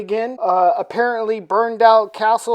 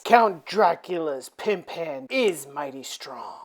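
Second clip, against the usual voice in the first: ordinary delivery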